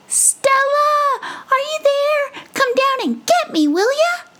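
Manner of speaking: very high, squeaky mouse calling up from below; shrill little mouse. Acted. Mouse calling up to his squirrel friend, high squeak. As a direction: call up high and tiny like a mouse. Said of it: "a shrill, small voice calling up to her".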